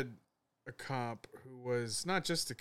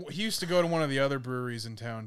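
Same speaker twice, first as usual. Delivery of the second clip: ordinary talk, nothing marked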